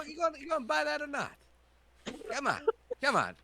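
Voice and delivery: high, fast, impatient merchant; pushy shopkeeper. Acted. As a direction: hurry the customer along. Shopkeeper rushing the buyer.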